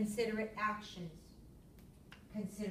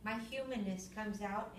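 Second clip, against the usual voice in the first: usual speaking voice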